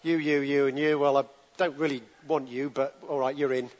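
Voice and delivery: lightly voiced bored God picking people, low and quiet; a casual shrug of a voice, mildly mocking; a hint of a careless king choosing his team; mock offhand God. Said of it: "It wasn't like God sat up in heaven".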